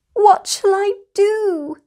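high, loud wail; a panicked little bear wailing for help. Acted. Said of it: "wailed Lucy".

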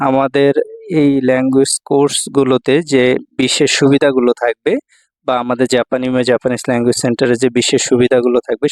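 emphatic, projected pitch for a language course; promotional announcement. Real, not acted. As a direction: announce the course word by word, with push. Promotes own language centre course. (জোর দিয়ে প্রচারমূলক ঘোষণা)

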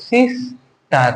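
"Sister" slowly broken into two beats, সিস and টার; two separate syllables in "sister", drawn apart; split into syllables. Shown as a demonstration. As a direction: say "sister" with a clear gap after সিস. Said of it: "দুইবারে, একবারে কিন্তু পারছি না".

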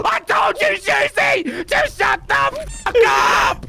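high, exasperated shout; angry shouting. Real, not acted. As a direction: shout it high, dragging out the last words. Angry at distracting friend in game.

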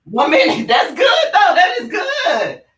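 strained excited cheer; straining, laughing. Real, not acted. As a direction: laugh through the burn. Mid-plank at one minute.

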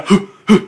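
short forceful huffs; exertion breaths. Shown as a demonstration. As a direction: puff out quick breaths between reps. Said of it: "Pwede lang po yung pahinga-hinga". (maiikling hingal habang nagbubuhat)

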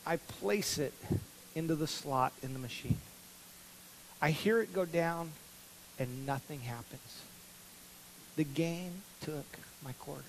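storyteller hushing the room as the coin vanishes; hushed suspense. Acted. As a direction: let the words sink like the coin. Dramatic loss of last quarter.

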